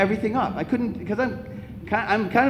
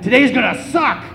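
raised, higher voice with punch; mock shout; 4 semitones higher than usual. Quoting dad greeting his day, mock shout. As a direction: belt out dad's gloomy motto lightly. Repeats dad's shouted line.

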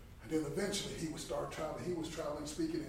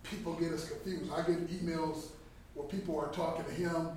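ordinary talk

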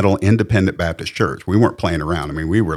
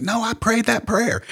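much higher squeaky defensive retort; mock protest. Mocking his own younger self answering the coworker, high and defensive.

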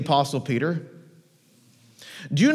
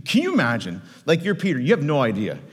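ordinary delivery

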